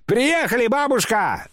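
very high, drawn calling. A narrator's reading. Narrator telling grandmother they have arrived, calling out high. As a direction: announce it in a mild high-pitched shout. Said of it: "закричал я".

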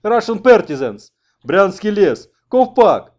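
loud, high-pitched frantic shouts; wild yelling. A narrator's reading. Struggling American yells at his rescuers, wild yelling. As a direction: scream out one accusation after another. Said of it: "завопил тот".

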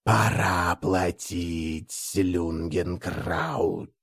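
slow, low, soft inhuman voice; a parasite speaking through a man; parasite inside human voice. Acted. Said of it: "голосом личинки".

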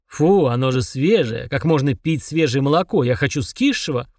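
a demon who hates fresh milk; disgusted demon voice. Acted.

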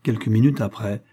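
ordinary delivery

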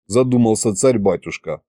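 plain reading voice

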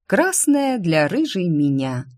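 plain reading voice

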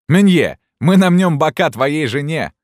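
high, loud jeering threat; threatening shouts. A narrator's reading. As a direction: yell a mocking threat across the hall. Students shout threats at bookseller.

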